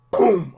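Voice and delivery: loud mock boom; loud sudden explosion sound; explosion noise. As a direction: shout a blast sound. Rocket launcher blast.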